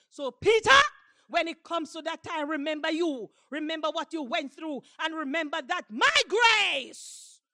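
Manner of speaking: loud, urgent charge with shouted key words; booming pulpit exhortation; full-force preaching. Acted. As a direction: proclaim it as a solemn charge.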